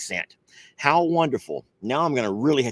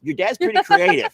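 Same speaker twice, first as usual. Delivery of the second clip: sing-song teasing, much higher; playful teasing; 4 dB louder than usual. Real, not acted. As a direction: tease her in a high playful lilt.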